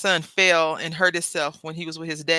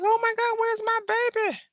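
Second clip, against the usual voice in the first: put-on much higher, panicked cry for a lost child; mock panic. Mother imagining her lost son, panicked high cry. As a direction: act out a parent's sudden panic. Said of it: "panic will set into your mind".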